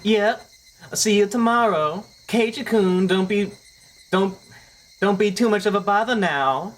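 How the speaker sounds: put-on high cheerful girl voice; anime girl voice. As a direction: play the sweet schoolgirl.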